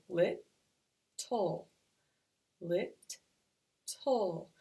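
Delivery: "lit", pause, "tull", evenly repeated; syllable by syllable. Shown as a demonstration. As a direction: separate beats on lit and tull. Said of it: "So again, let's go".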